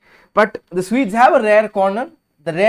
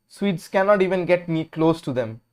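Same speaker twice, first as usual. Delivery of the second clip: ordinary talk, nothing marked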